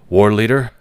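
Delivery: a soldier's sharp loud greeting; loud hail. Acted. Soldier calling out to his war leader, loud. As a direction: call it out loud to your commander.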